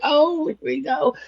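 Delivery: drawn-out, high, resigned; exasperated, dragging the words; weary here we go. Retold or imitated. Dreading his AI talk.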